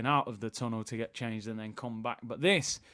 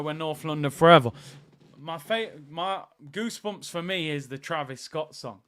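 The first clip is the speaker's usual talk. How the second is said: normal talking voice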